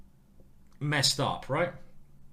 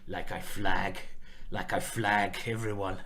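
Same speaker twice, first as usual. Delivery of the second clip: low, slow put-on French accent; hammed-up, not very convincing French accent; bad French accent; 5 semitones lower than usual. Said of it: "That's my French accent".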